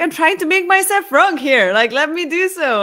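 high, loud exasperated whine; annoyed protest. Retold or imitated. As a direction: plead loudly to be left to it. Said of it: "I find really annoying".

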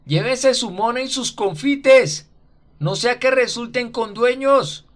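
high, slow, hoarse call after her; hoarse shout. A narrator's reading. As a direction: yell hoarsely after the girl. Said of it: "le grita ronco".

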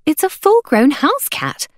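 put-on high-pitched scornful girl; insist, refusing to be fooled; insistent correction. A narrator's reading. Girl contradicting the hawker about the cat, insistent. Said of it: "May Lin insisted".

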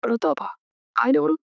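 high, puzzled questions; puzzled surprise. Acted. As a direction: ask in bewildered disbelief. Woman puzzled by daughter's bitterness. (ప్రతాప ఎవరని ఆశ్చర్యంతో ఎత్తు స్వరంలో అడగడం)

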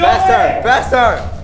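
high, loud push to hurry; urging shout. Real, not acted. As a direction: yell at him to speed up. Urging friend down the slide.

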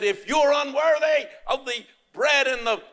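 high-pitched imitation of a threatening preacher; condemning minister. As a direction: mimic a minister warning of damnation. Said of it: "When the minister said".

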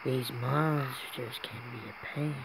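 soft shopkeeper; quiet shopkeeper voice. Acted. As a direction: speak softly as the merchant.

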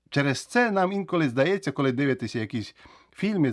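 normal talking voice

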